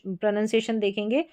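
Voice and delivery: ordinary delivery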